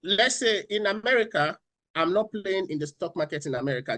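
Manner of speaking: usual speaking voice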